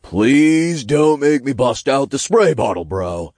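high, loud imp plea; mock-stern warning from an imp. A narrator's reading. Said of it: "Todd warned".

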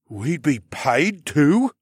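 put-on high, very slow astonished boy; amazed gasp. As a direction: gasp it in wonder. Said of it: "Beryl gasped with wide eyes".

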